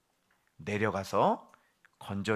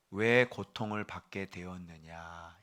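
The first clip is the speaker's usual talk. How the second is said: lower, slow, gentle question; calm divine answer; 6 semitones lower, 7 dB quieter, slower than usual. Acted. The Lord answering the sufferer, softly and slowly. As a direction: ask it back softly, like the Lord. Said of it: "주님은 이렇게 대답하는 거예요".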